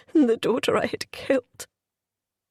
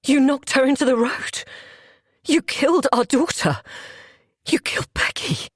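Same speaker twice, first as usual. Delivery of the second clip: furious accusation; 4 semitones higher than usual. Acted. Husband accusing her.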